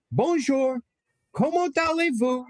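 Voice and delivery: high, sing-song French greetings; eager tourist French. Retold or imitated. Teen tourist greeting Montreal strangers, high sing-song French. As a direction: chirp Bonjour at everyone like a proud tourist. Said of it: "Stop effing speaking French to everybody".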